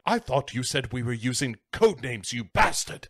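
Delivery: put-on indignant villain voice; mock-outraged crime boss; Lithgow. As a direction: scold your henchman, hammed-up. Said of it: "Lithgow gives him shit".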